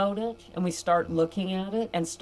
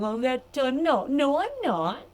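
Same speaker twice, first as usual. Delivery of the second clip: mock denial; 5 semitones higher than usual.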